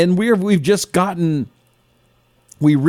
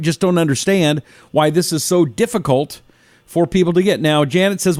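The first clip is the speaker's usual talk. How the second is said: normal talking voice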